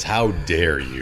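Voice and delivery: mock outrage. Real, not acted. Mock outrage at a page that spoiled a cameo. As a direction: drag out the fake offense.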